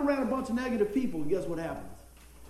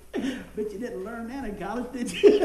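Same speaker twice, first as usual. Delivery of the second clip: teasing through laughter; laughing jab, voice jumping louder at the end; laughing tease; 2 semitones higher, 8 dB louder than usual. Retold or imitated. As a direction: tease him while laughing. Sister-in-law teasing quote.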